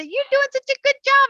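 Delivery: high, loud sing-song praise; praising the bird. Retold or imitated. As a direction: gush at the parrot like to a toddler. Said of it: "So we talk to them and say".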